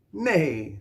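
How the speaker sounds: high, loud, drawn-out neigh; whinny the word like a horse; playful horse neigh. Pretend. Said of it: "says the horse".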